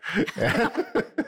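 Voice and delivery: high bright burst of laughter; big laugh. Real, not acted. Guest laughing after rattling the pill bottle, high laugh. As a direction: laugh at the punchline.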